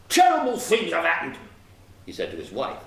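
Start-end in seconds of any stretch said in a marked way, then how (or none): 0.1-1.4 loud, high outburst; raging wagoner. A narrator's reading. Wagoner reporting disaster to his wife, agitated outburst. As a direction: burst out with it, upset and loud.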